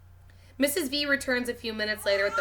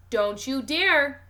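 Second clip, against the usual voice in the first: warning; 4 semitones higher, 6 dB louder, much slower than usual. A narrator's reading. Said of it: "warns her in her "I mean it" voice".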